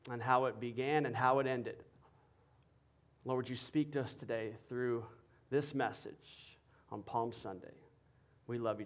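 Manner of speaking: soft, low prayer voice. Acted. Pastor praying for the congregation, soft and low. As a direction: pray quietly over the church.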